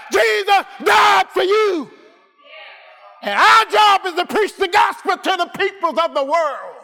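high, ringing sermon shout; preaching at full force. Acted. Preacher proclaims Jesus died for all, preaching at full force.

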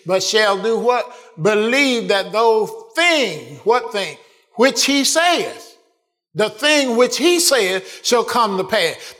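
loud, high, fervent preaching; preacher building to a climax before the congregation, loud and fervent; preaching at full force. Acted. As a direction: press it home from the pulpit, loud.

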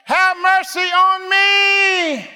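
high, sustained plea; crying out. Acted. Preacher acts blind Bartimaeus calling to Jesus, crying out. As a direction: call out high, drawing the words long. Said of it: "What did he cry out?".

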